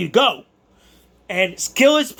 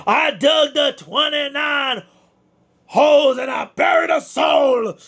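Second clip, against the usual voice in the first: Undertaker impression; 6 semitones higher, slower than usual. Winner doing a wrestler's catchphrase after his fight, slow shout. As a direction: boom it out like a hammed-up wrestling promo. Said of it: "Thank you, Undertaker".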